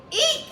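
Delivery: very high, loud squeak; a frightened bat's high squeak. A narrator's reading. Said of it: "squeaked the bat".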